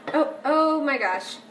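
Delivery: ordinary delivery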